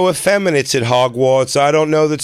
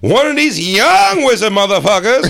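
put-on cranky old woman; 9 semitones higher than usual. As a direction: holler like an outraged grandma. Cursed old woman ranting.

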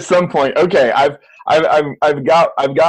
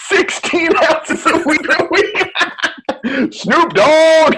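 high, slow laughing disbelief; 13 semitones higher, much slower than usual. Real, not acted. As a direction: laugh while repeating it. Laughing at sixteen ounces.

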